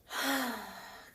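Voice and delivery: long, airy sigh out through the mouth; deep exhale. Shown as a demonstration. Instructor demonstrating deep breaths to listeners, long exhaled sigh. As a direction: let out a slow cleansing breath. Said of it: "breathe out of the mouth".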